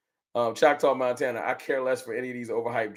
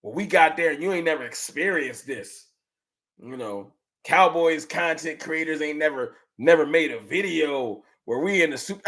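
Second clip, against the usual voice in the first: ordinary talk, nothing marked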